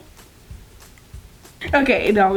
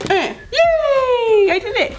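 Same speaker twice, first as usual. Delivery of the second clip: high, stretched-out cheer; celebrating; 11 semitones higher, much slower than usual. Real, not acted. Made a bubble for child, high triumphant cheer. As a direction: cheer your own success, pitched way up.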